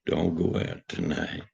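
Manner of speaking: slow, soft, foreboding warning; hushed spooky warning; ominous warning. Acted. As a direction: warn them darkly, hushed and slow.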